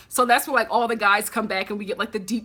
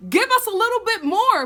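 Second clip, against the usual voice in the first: much higher, louder urging; urging shout at Peter; 10 semitones higher, 5 dB louder than usual. Retold or imitated. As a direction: yell at the screen to hug her. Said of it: "I'm like".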